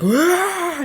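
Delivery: high yelp of pain; mock startled yelp. Acting out Dracula touching the garlic stake, a high yelp. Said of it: "and he's like".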